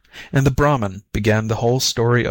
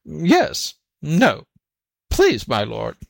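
high, hesitant yes and no; lightly voiced timid animal; flustered jackal; 5 semitones higher, slower than usual. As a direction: dither meekly before a master. Jackal feigns timid confusion.

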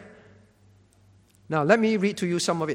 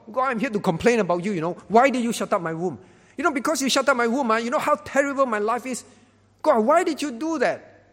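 higher-pitched, hammed-up grievance to God; mock complaining; 4 semitones higher, 4 dB louder, faster than usual. Preacher parodying a prayer that complains to God, mock complaining. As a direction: act out an indignant complaint, pitch climbing toward the end. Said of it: "complain to God".

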